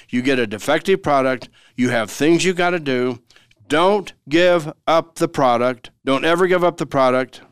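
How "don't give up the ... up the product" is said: emphatic warning. Real, not acted.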